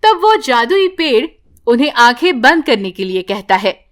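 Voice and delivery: plain reading voice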